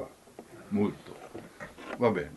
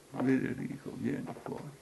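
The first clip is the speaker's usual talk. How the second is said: soft call fading to breath; higher, hushed, nearly whispered; feeble voice; 4 semitones higher, 7 dB quieter than usual. Retold or imitated. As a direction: imitate a timid, faint attempt. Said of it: "in un modo fievole".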